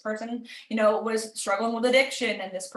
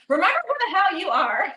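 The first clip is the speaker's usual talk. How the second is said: mock tough love; 7 semitones higher, 4 dB louder than usual. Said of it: "give you that swift kick".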